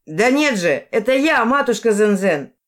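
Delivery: loud, high-pitched protest; shouting. A narrator's reading. Ас protests to the villagers that he is no spirit, shouting. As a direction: shout to convince a doubting crowd. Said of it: "крикнул ас".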